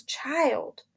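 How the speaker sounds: frightened whisper, barely voiced; hushed, scared call to the child; tense whisper. A narrator's reading. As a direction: breathe the word in fear. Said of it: "There is real tension in his voice".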